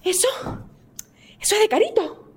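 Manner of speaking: mock startled cry. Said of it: "me dice".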